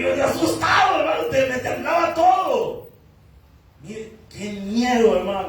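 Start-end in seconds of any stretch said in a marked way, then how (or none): 0.0-2.9 excited shout. Retold or imitated. Preacher reliving his first sermon in prison, excited shout. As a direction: blurt it out as if the fear is back.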